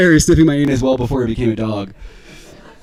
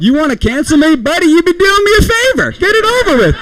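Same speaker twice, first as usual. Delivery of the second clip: high-pitched exasperated yell; hammed-up desperate rant; 13 semitones higher, 4 dB louder than usual. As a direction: yell it, begging to be put out of it. Acted rant at would-be cancellers.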